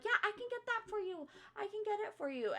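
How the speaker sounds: very high, eager-to-please mimicry; customer-service voice. Manager granting a customer the discount, mock customer-service voice. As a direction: pitch it way up, mock-obliging. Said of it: "doing the whole customer service thing".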